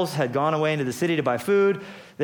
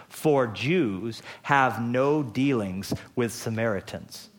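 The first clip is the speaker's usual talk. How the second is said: slow explanatory note; 3 semitones lower, much slower than usual. Shown as a demonstration. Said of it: "explanatory notes from the author".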